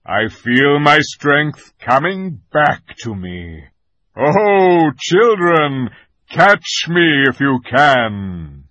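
a lion challenging to play; playful lion. A narrator's reading. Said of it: "said the lion".

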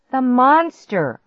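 high, loud, stretched exclamation; yell. A narrator's reading. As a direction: yell it urgently. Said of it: "I yelled".

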